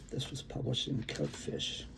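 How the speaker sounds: normal talking voice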